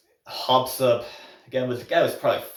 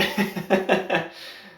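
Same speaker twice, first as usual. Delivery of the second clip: airy burst of laughter; breathy laugh; 9 semitones higher than usual. Real, not acted. Laughing at the story.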